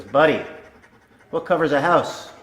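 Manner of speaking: eager pet-trick question; man prompting dog. Acted. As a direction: prompt your dog like a showman. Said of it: "turns to his dog and he says".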